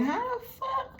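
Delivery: high, baffled mock outrage; squeaky disbelieving husband; confused. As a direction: imitate a man who can't believe what he sees. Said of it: "somebody was confused".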